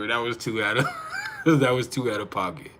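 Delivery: real amused laughter interrupting the words; laughing. Real, not acted. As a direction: laugh midway through saying it. Laughs at an outrageous moment.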